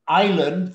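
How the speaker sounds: "island" pronounced eye-lund, no S sound; silent S. Shown as a demonstration. Teacher correcting a student's reading, "island" with a silent S. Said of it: "the S is silent".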